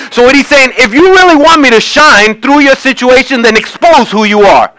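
high, driving sermon voice; impassioned preaching. Real, not acted. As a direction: preach with full conviction to the congregation. Preacher at full force.

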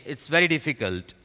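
ordinary delivery

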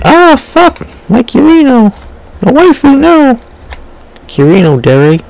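high panicked shriek; loud panic as the figure falls; excited anime fan. Real, not acted.

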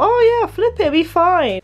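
high, breezy self-mockery; mock carefree inner voice. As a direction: toss it off like it's nothing.

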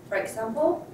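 ordinary talk, nothing marked

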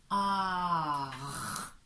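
"ar" with long A into R; syllable demo. Shown as a demonstration. Teacher shows A comes before R, drawn out. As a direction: stretch "ar" right out. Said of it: "le A est avant le R".

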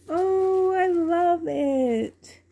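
high, loud, stretched-out coo; delighted gushing. Real, not acted. Delighted by gifted baby outfit.

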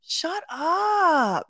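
sharp, high yell at the screen; yelling shut up. Real, not acted.

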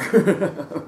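warm short laugh from the pulpit; preacher's quick chuckle. Real, not acted. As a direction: chuckle at the joke. Laughs at own joke.

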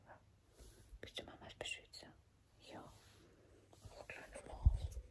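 soft whisper so no one wakes; hushed whisper into the phone camera. Real, not acted. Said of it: "Ich kann nicht so laut reden". (leises Flüstern ins Handy)

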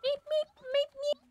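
tiny high-pitched beeps; squeaky falsetto chirps; chick language. Pretend. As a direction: peep like a baby bird. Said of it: "I can speak their language".

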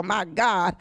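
usual speaking voice

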